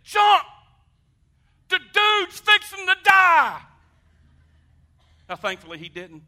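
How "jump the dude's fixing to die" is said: high, loud slow yell; drawn-out high panicked shout. Retold or imitated. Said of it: "I said".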